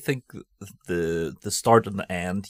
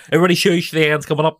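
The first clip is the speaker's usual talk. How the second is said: mock shush; 8 semitones higher, 10 dB louder, faster than usual. To the pub audience, loudly calling for hush. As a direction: hush the quiz crowd, hammed up.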